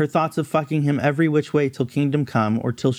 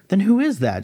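higher and a bit louder, alarmed; loudly; 8 semitones higher than usual. A narrator's reading. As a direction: call it out, mildly startled. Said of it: "she said loudly".

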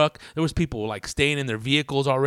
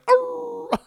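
dog bark imitation. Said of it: "The little dog's like".

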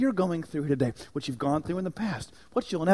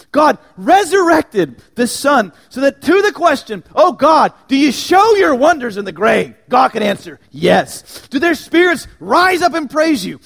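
much higher and louder, shouted call and answer; full-force preaching; 8 semitones higher, 14 dB louder than usual. Real, not acted. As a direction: proclaim it triumphantly to the crowd.

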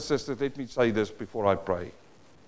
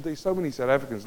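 ordinary talk

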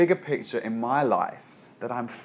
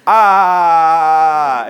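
loud high held wail of panic; a long loud terrified "ah"; mock wail; 4 semitones higher, 11 dB louder than usual. Pastor acting the panicked servant, loud held wail. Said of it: "is how it translates".

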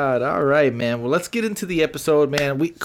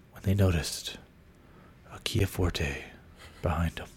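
murder podcast narrator; 8 semitones lower, 11 dB quieter than usual. Retold or imitated. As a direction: whisper it like a true-crime host building dread. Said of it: "the guy was like".

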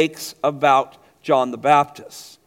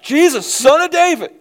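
high, loud call of a blind beggar; crying out; 9 semitones higher, 5 dB louder than usual. Retold or imitated. Blind man crying out to passing Jesus, loud and high. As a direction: call out to Jesus over the crowd. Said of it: "and he's crying out".

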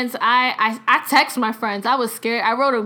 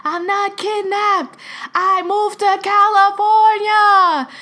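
loud, high, slow phone holler; drawn-out shouted imitation; re-enacted screaming; 10 semitones higher, 6 dB louder, slower than usual. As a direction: yell each word out for someone hard of hearing. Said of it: "screaming on the phone".

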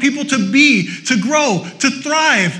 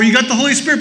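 ordinary delivery

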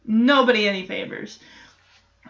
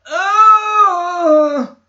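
mock groan; 7 semitones higher, 7 dB louder than usual. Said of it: "is going".

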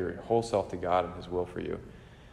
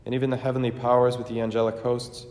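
raised, ringing liturgical chant-speech; solemn preface; 4 semitones higher, 5 dB louder than usual. Acted. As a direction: proclaim it to the whole church. Sung-speech preface, raised and louder.

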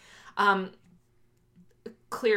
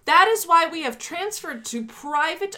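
raised, oratorical political read-aloud; formal manifesto reading; 8 semitones higher, 6 dB louder than usual. Acted. Host reading privatization section to co-host, declaimed. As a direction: declaim it in rising, emphatic phrases.

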